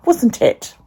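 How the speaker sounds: ordinary delivery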